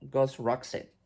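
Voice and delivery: usual speaking voice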